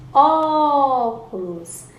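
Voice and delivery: "Óculos" called out with a drawn-out Ó; "Óculos" with a long, ringing first syllable; calling out the word. Shown as a demonstration. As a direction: hold the first vowel of "Óculos", high and loud. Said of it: "A sílaba que eu mais demorei".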